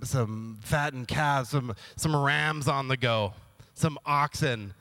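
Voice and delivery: ordinary talk, nothing marked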